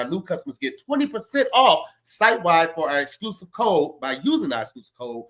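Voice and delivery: ordinary delivery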